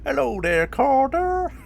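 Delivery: very high, loud imitation; mimicked greeting. Streamer mimicking how he greets Carter, high mimicked voice. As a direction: put on a high, blaring mimic voice. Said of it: "that's how he sounds".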